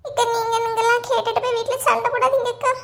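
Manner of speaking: high, whining put-on plea; fake tearful pleading. Gita begs the neighbour not to fight at home, fake tearful pleading. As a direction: beg tearfully, laying it on thick. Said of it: "என்னமா நடிப்பு நடிக்கலுவ".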